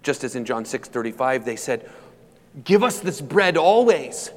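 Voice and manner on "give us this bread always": higher, drawn-out plea; crowd demanding. Acted. The crowd asking Jesus for bread, demanding. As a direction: beg for it slowly, pitch climbing. Preacher acts out the pleading crowd.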